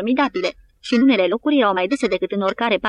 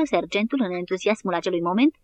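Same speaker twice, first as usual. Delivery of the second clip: ordinary delivery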